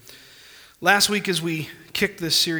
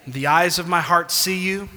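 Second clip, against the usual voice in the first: loud, rising declaration with long pauses; confession of faith; 3 semitones higher, 8 dB louder than usual. Shown as a demonstration. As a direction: lead the church in a confession, phrase by phrase. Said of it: "Can you do this with me? Say".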